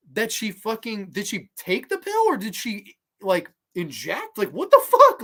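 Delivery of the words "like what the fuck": high, loud incredulous shriek; real shocked squeal; shrieked disbelief. Real, not acted. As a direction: blurt it out way up high. Baffled outburst about injection.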